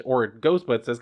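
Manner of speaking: normal talking voice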